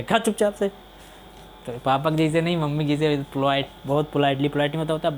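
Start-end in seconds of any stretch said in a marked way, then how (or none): 0.0-0.7 higher, snapping stern dad; stern father. Retold or imitated. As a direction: order the child to eat like an annoyed dad. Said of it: "पापा आते थे दुई लपड़ खींच के देते".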